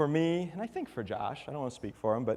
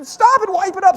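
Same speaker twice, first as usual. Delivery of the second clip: urgent yell; 12 semitones higher, 9 dB louder than usual. Retold or imitated. Urgent shout over dripping brain.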